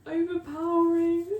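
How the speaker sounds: overwhelmed, near tears; worked-up rant. Real, not acted. Vlogger overwhelmed by kitchen smells, loud worked-up rant.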